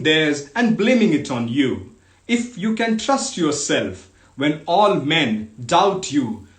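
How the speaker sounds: measured, emphatic reading with falling phrase ends; poem recitation. Acted. Host reading Kipling's fatherly poem to online listeners, emphatic recitation. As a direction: declaim each clause with deliberate pauses.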